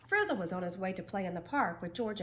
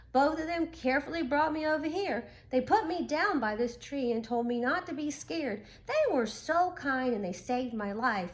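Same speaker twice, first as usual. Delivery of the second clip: slightly louder, eager little storyteller; lightly voiced young turtle; 5 semitones higher, 5 dB louder than usual. A narrator's reading. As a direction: rush through the adventure like a kid. Turtle tells his rescue story.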